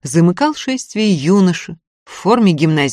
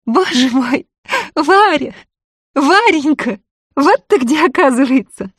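high, ringing happy shout; joyful cry; 9 semitones higher than usual. Acted. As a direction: call out in delighted surprise. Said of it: "закричала на всю квартиру звонко и радостно".

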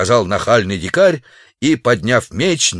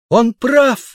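high, louder sudden exclamation; excited cry; 3 dB louder than usual. A narrator's reading. Girl suddenly crying out for the savage, high excited cry. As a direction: burst in to defend him. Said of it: "воскликнула вдруг непричёсанная девушка".

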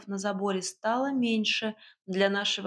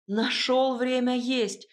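slow, higher-pitched protest; indignant; 4 semitones higher, 4 dB louder, slower than usual. A narrator's reading. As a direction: draw it out in indignation. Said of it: "возмутилась я".

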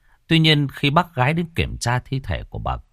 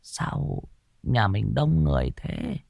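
put-on puzzled old woman; 8 semitones higher than usual. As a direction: ask like a confused old lady just woken. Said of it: "bà cụ hỏi".

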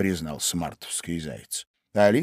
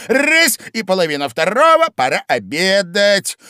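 high, loud, excited Hatter; a lively know-all Hatter; loud, high, bustling Hatter; 13 semitones higher, 11 dB louder than usual. A narrator's reading. Hatter explains time.

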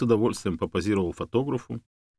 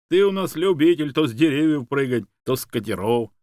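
lively, raised joking voice; amused teasing; 5 semitones higher, 5 dB louder than usual. A narrator's reading. Man joking about the dog's past jumps, amused. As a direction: rib the dog good-naturedly. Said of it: "рассмеялся Юрий".

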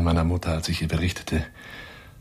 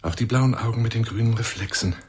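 ordinary talk

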